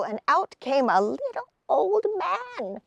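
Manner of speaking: usual speaking voice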